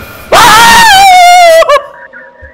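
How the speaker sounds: very high, loud wordless scream; shrieking scream. Real, not acted. Streamer hit by the final jump scare, a wordless shriek. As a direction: let out a full terrified shriek.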